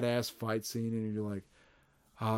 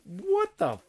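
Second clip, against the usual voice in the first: much higher, slow squeal of disbelief; outraged disbelief; 13 semitones higher than usual. Real, not acted. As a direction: stretch it out, scandalised.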